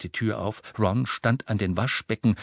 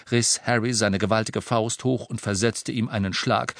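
plain reading voice

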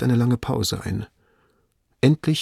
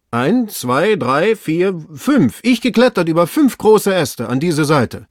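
higher, louder voice of a servant up a tree; raised, eager servant call; servant calling down, counting; 7 semitones higher, 6 dB louder, slower than usual. Acted. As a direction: count aloud and report down to the master.

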